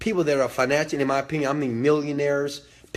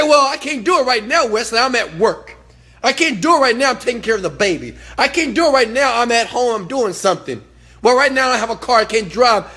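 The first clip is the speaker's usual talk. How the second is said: loud, high mimicry of excuses; mocking excuse-makers; 7 semitones higher, 6 dB louder than usual. Followers telling Wesley why they can't, mocking protest. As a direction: reel off excuses in mock protest, voice raised.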